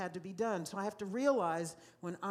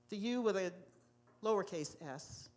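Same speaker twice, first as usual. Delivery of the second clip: ordinary delivery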